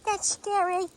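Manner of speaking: high sing-song that's scary to the dog; soothing. Real, not acted. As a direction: comfort the frightened puppy. Soothing a scared puppy.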